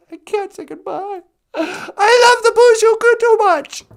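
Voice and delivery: loud fake crying with laughter; laughing mock sob. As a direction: wail it, half laughing.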